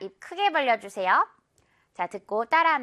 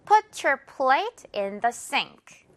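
slow model with sink as siŋk; slow drill; much slower than usual. Shown as a demonstration. Teacher models for repeat, very slow. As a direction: Put your plate in the sink said very slowly. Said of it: "자, 갑니다".

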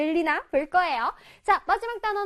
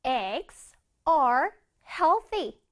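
very slow, drawn-out reading; slow model reading; much slower than usual. Shown as a demonstration. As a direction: read it slowly for the class. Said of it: "문장에서 보자".

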